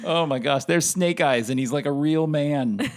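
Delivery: a grown man giddy at the screen; retold excitement. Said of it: "I was so excited".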